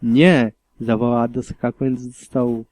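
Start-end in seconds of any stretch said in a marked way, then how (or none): 0.0-0.5 cry out. A narrator's reading. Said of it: "przerwał mu krzyk Eevee".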